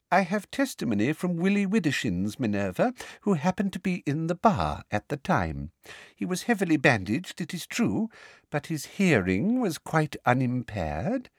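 teacher smugly answering McGonagall. A narrator's reading. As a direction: savour your evidence smugly. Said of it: "said Umbridge smugly".

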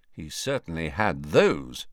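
ordinary delivery, nothing marked

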